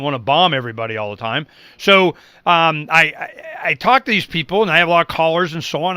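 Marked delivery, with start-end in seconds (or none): none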